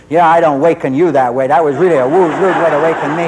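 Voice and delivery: loud mock complaint, imitating a snarly son. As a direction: throw the grievance out loudly, acting it. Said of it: "he was really snarly".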